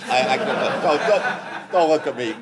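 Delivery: high, laughing protest. Real, not acted. Man dodging a silly question from the group, laughing. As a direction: laugh it off, pleading to be left out.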